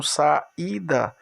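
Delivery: "saída" slowly split as sa, í, da; syllable by syllable. Shown as a demonstration. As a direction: let the í of "saída" stand alone. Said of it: "Quando eu separar a sílaba".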